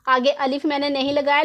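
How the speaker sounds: usual speaking voice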